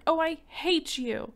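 high, slow, loud hurt cry; tearful boy turning on his father; hateful outburst. Acted. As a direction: spit it at your father through tears.

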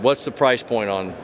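ordinary talk

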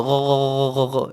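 rapid run of throaty gargled "gho-gho-gho"; imitated gargle. Shown as a demonstration. Teacher imitating gargling for students, rattling throat noise. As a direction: gargle the sound from the back of the throat. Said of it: "গড় করার মতো".

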